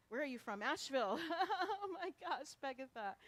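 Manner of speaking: loud, high burst with a laugh; delighted greeting. Real, not acted. Minister spotting a visitor from Asheville, excited and laughing.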